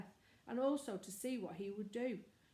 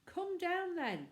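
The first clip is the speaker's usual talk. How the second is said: high, louder, slow call; shout; 10 semitones higher, 5 dB louder, slower than usual. A narrator's reading. Manservant calling to the boys above, shouting. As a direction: shout up to the tower.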